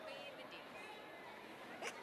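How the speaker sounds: whispered, laughing Clear the Dicks, Dicks for Decks; New Zealand accent. Pretend. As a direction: breathe Clear the Dicks through a laugh, Kiwi style. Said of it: "I can't do a New Zealand accent".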